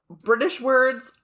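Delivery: ordinary talk, nothing marked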